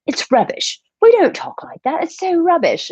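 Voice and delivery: high-pitched mock British critic; British accent. British people dismissing her accent, high mock British voice. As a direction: put on a squeaky, scoffing British accent. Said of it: "I talk to British people and they're like".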